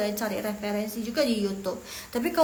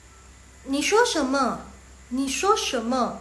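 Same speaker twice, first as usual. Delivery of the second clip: slow Mandarin question, raised pitch; slow model reading; 3 semitones higher, 3 dB louder, much slower than usual. Shown as a demonstration. Teacher introduces phrase number five, slow model reading. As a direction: sound out the question for learners. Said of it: "Yang nomor lima".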